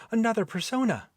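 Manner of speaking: ordinary delivery, nothing marked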